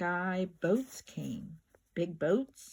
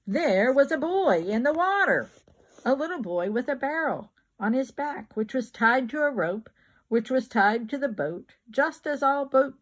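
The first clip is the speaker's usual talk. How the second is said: excited storytelling; 5 semitones higher, 6 dB louder than usual. A narrator's reading. Excited narration of the boy.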